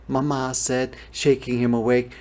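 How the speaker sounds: usual speaking voice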